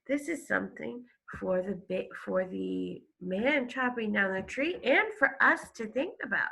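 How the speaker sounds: ordinary delivery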